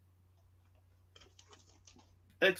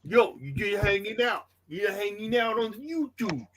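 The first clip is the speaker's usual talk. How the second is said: high-pitched mock New York hustler; ribbing Tony in a put-on New York accent; 8 semitones higher than usual. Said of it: "my New York accent's getting better".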